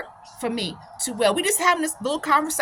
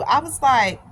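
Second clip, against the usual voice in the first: ordinary delivery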